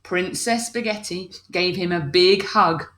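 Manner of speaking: ordinary delivery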